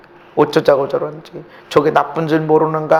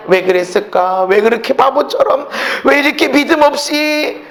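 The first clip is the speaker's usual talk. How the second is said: self-reproach; 10 semitones higher, 4 dB louder than usual. Retold or imitated.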